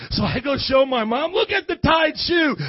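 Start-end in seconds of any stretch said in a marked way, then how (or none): 1.3-2.6 high, loud proud announcement; proud shout. Retold or imitated. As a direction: show off the shoe proudly. Boy proudly shows mom shoe.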